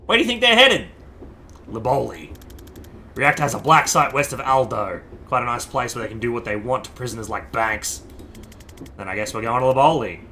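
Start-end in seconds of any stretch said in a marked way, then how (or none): none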